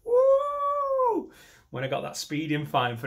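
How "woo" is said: shrieking high war cry; hammed-up whoop; high battle whoop. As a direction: let out a wild high whoop. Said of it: "I'm like".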